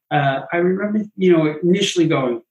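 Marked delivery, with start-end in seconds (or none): none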